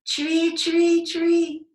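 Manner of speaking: high, loud birdsong calling; robin call. Acted. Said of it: "So she began calling".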